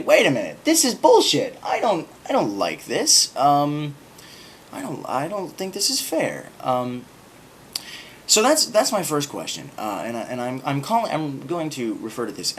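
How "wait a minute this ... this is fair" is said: high, loud indignant protest; rebellious outburst. Pretend. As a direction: rage against the unfair verdict. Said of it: "would you say".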